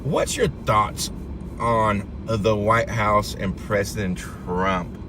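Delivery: ordinary talk, nothing marked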